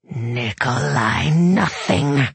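slow snarling demon voice. A narrator's reading. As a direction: snarl it like a chained beast. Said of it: "snarled the demon".

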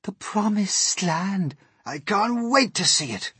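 high, thrilled exclamations; high, eager calling; excited shouts. A narrator's reading. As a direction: cry out in mounting excitement. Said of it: "mounting excitement and shouts of".

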